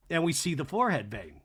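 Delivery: usual speaking voice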